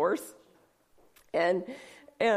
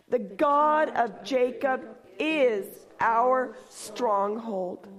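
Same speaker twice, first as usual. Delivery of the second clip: slow, measured reading of a psalm; solemn scripture reading; slower than usual. Acted. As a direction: read it out slowly like holy scripture.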